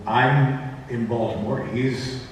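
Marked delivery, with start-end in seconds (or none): none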